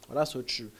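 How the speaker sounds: usual speaking voice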